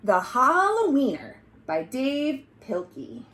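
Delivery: ordinary delivery, nothing marked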